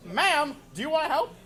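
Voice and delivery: usual speaking voice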